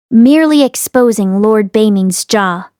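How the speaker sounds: ordinary delivery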